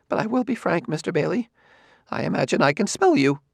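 a hint of a nervous scholar; Quemot's voice. A narrator's reading.

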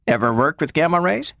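casual question lifted high; friendly, offhand. A narrator's reading. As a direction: ask lightly, as if it hardly matters. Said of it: "came in a friendly, offhand manner".

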